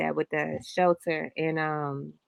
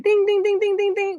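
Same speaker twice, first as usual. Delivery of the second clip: high sung ding ding; slot machine ding; 13 semitones higher, 4 dB louder than usual. Retold or imitated. Imitating the casino slot machine jackpot, high ringing dings. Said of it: "The damn thing started".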